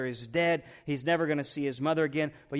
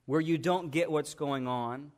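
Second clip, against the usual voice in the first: ordinary delivery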